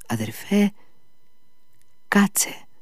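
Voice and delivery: frightened hushed plea. A narrator's reading. Alyosha scared for his feverish brother, frightened hushed plea. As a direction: whisper it, scared for him. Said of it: "πρόφερε φοβισμένος ο Αλιώσα".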